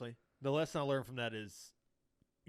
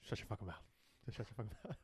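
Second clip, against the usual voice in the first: much softer, under the breath; muttered mock threat; 4 semitones lower, 13 dB quieter, faster than usual. Replaying the coworker's line to the apprentice, under the breath. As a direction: mutter it low like a private threat. Quiet echo of the outburst.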